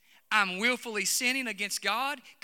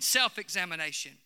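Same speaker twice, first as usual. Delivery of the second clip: ordinary delivery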